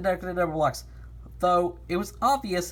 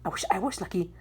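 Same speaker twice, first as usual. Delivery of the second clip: whispered; faster than usual. Acted. Wistful character line.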